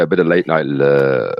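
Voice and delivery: emphatic midnight DJ. Shown as a demonstration. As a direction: drop low and linger on "love". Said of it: "put a bit of emphasis and go".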